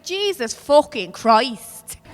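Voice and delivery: "Jesus fucking Christ" drawn out slowly, each word punched; exasperated mock curse. Comedian shows God's middle name, "Jesus fucking Christ" slow and emphatic.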